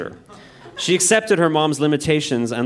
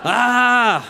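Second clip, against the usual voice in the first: high, drawn-out "Ah!"; mock-horrified high wail; acted cry of dismay; 10 semitones higher, 4 dB louder than usual. A narrator's reading. Daughter's cry at mother's threat.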